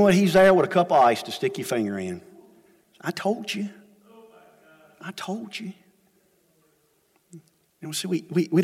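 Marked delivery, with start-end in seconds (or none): 3.0-5.7 softer, tender told-you-so; a quiet tender reproach; gentle I told you. Retold or imitated. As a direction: soften it like a loving father. God gently telling us so.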